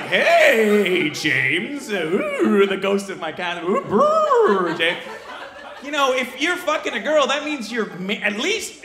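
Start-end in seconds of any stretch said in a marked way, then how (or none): 0.0-5.0 hammed-up ghost with swooping wail; haunt him with drawn-out ghostly oohs.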